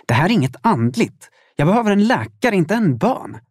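exasperated outburst, rising in pitch; hurried, mildly shouted protest; called out angrily. A narrator's reading. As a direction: snap back in quick, heated frustration. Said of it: "ropade jag och slängde igen dörren".

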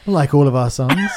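laughing through the words; giggling while talking. Real, not acted.

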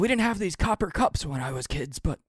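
put-on high old-man voice, wistful storytelling; an old man remembering his youth; reminiscing old man.

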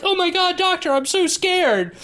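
high-pitched hammed-up scared nurse; mock panic. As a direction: imitate a frightened movie damsel, voice way up.

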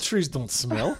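put-on Ukrainian accent with a laugh; comic Ukrainian accent, amused underneath; Natalie. Bride explaining trees back home, laughing Ukrainian accent. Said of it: "She talks like this".

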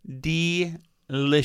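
slow, drawn-out delicious; a slow savouring murmur. Real, not acted. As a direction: linger on the word like it tastes great. Savouring the hot sauce.

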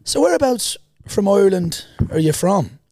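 high, lilting Irish question; imitated Irish woman. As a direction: mimic a curious Irish lady. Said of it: "she's like".

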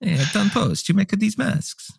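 put-on high, slow Italian mobster accent. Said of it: "That's my Italian accent".

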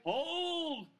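high, loud urgent shout; shouted hold. Real, not acted. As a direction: yell it to stop him. Calling out to steady friend.

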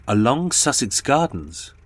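ordinary delivery, nothing marked

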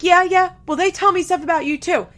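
high, loud, quick comeback; mock angry retort. Leah arguing back at Corey, high mock-angry retort. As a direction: fire back loudly in mock outrage. Leah's retort in retold quarrel.

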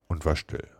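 ordinary delivery, nothing marked

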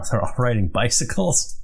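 normal talking voice

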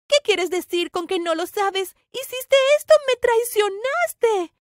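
high, loud, building reproach; angry accusation. Acted. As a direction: accuse a traitor, stretching the words. Pelea, acusa de traición. (reproche furioso, agudo y alargado)

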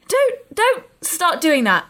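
slow, high, insistent protest; mock exasperated telling-off. Telling companion not to start whispering, mock exasperated. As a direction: plead firmly, spacing the words out.

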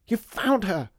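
high, loud burst of relief; excited cry. A narrator's reading. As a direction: cry out as you rush in. Ivy bursts in, relieved, calling out.

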